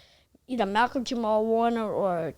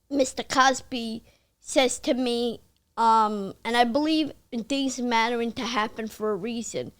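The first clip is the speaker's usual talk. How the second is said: ordinary delivery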